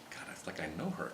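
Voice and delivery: breathy whisper to herself; hushed aside, barely voiced; whispered thought. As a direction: murmur it like a private thought. Inner thought, said under breath.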